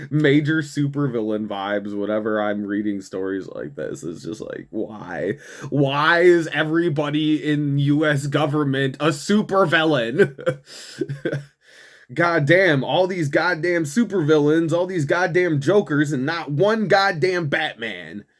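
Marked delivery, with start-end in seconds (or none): none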